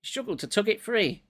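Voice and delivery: ordinary delivery, nothing marked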